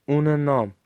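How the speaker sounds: ordinary delivery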